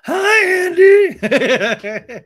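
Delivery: very high, loud squeaky greeting; shrieky mock-sweet impression; Raquel. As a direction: squeal it in a hammed-up voice. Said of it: "Raquel sits down".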